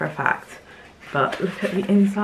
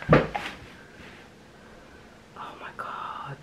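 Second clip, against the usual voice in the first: awed whisper; 11 dB quieter than usual. Real, not acted. Seeing the mermaid stationery set.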